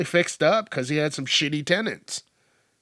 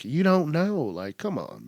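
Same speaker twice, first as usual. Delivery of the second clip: ordinary talk, nothing marked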